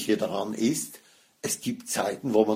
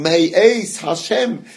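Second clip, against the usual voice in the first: „Eis“ punched higher and louder, said slowly; stress on EIS; 6 semitones higher, 6 dB louder, slower than usual. Shown as a demonstration. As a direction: hammer „May Eis Hashem“ out slowly and loudly, peak on „Eis“. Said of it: "sondern Betonung". (laut und langsam betontes „Eis“ in „May Eis Hashem“)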